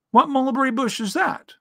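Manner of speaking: high little bear voice; puzzled Pooh. A narrator's reading. Bear rubbing his nose at his friend's remark, high and puzzled. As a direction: ask it high and innocently puzzled.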